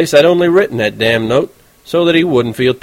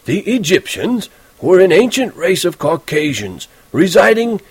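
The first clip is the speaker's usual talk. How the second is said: higher old man's voice, slow and mocking; pompous reading aloud; 8 semitones higher, slower than usual. A narrator's reading. Teacher reading Holden's essay aloud, mocking. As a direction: read the boy's essay back to him with scorn.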